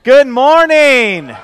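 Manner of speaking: loud, slow, stretched good morning; big loud greeting. Shown as a demonstration. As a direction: boom it out to wake the room. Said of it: "No, no, no, no, no".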